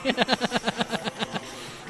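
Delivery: high-pitched sustained laugh; helpless high giggling laugh; real laughter. Real, not acted. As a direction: crack up at a co-host's joke. Cracking up at the pronunciation joke.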